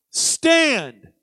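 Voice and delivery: "Stand" alone, long and falling; a drawn-out, weighty "Stand"; emphatic "stand". Acted. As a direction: hit "Stand" firmly and hold it. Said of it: "emphasizing the word stand".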